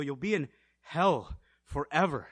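ordinary delivery

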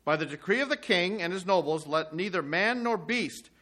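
measured reading of scripture. Acted. Preacher reading Jonah's prayer to the congregation, measured. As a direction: read the prayer steadily to the listeners. Preacher reads chapter three aloud.

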